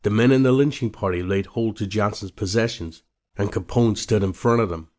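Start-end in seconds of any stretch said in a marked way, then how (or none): none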